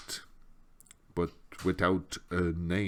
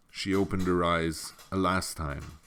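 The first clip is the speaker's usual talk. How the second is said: ordinary delivery, nothing marked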